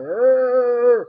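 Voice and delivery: loud, far-off moaning groan; cultist groan. Retold or imitated. Mocking cloaked cultists with a loud groan. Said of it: "wandering around like".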